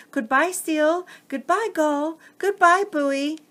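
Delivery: tiny crabs waving farewell from afar; small eager voices. Acted. Crabs call goodbye to friends as they sail away, small eager voices. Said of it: "Voices, small and eager".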